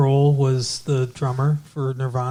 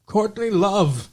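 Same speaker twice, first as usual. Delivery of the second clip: Jack's voice; 5 semitones higher, slower than usual. Retold or imitated. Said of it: "And he's like".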